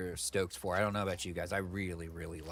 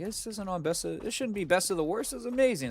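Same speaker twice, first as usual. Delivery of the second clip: much higher, mocking quoted voice; mock gushing reviewers; 11 semitones higher than usual. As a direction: parody the critics gushing, pitched way up. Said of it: "they're all like".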